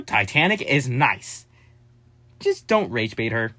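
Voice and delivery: ordinary talk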